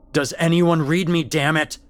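loud, high, exasperated demand; desperate, angry call for a response; frustrated radio shout. Acted. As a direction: shout into a radio nobody answers.

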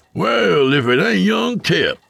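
high, bright greeting; cheerful country man; bright drawl. A narrator's reading. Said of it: "Abel said brightly".

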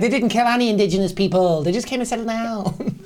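mock-innocent Midwest accent. Excusing the settlers as a joke, Midwest accent. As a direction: defend them in a folksy Midwest voice.

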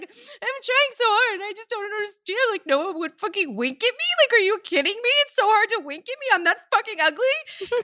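high, wailing mock sob, whiny and tearful; mock sobbing. At the office, wailing to her boss about zero winks, mock sobbing. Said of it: "I melted into tears".

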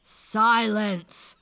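very loud, booming command; great voice bellowing one word; giant's roar. A narrator's reading. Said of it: "roared a great voice".

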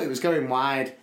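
normal talking voice

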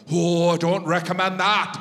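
imitated Irish sound guy; Irish accent. Sound guy reacts to the song choice, mock Irish accent. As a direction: warn him off in a put-on Irish accent. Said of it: "the sound guy was like".

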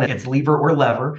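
first "lever" LEE-ver, second "lever" LEV-er; both pronunciations. Shown as a demonstration. Guest explaining the border split, "lever" as LEE-ver, then LEV-er. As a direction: contrast "lever" rhyming with beaver, then "lever" rhyming with never. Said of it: "depending on which side of the border".

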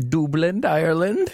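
mock Irish accent on the city name; hammed-up Irish lilt, slow. Cohost reading tour cities, in a mock Irish accent. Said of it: "That was my accent".

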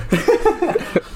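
sudden high-pitched laugh. Real, not acted. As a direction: burst out laughing. Joke about swapping roles.

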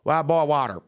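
imitated Memphis waitress, thick accent. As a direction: announce it in a broad Memphis accent. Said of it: "she had a very thick accent".

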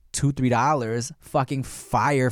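usual speaking voice

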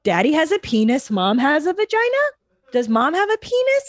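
put-on high, rising child questions; curious small son quizzing mom in a high voice; kid asking. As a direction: ask like a curious toddler. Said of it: "they love discovering".

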